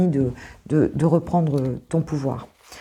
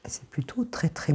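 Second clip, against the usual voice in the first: chuchoté; 8 dB quieter than usual. Real, not acted. Murmure en tirant une carte.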